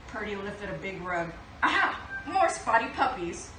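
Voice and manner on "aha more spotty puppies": high, loud triumphant aha; delighted discovery. Acted. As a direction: found them, burst of joy. Purdy finds puppies under rug.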